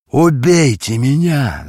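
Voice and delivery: loud, high, slow anguished shout; desperate drawn-out shout; hoarse cry. A narrator's reading. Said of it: "закричал он хрипло".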